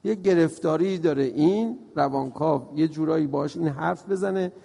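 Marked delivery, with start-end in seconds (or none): none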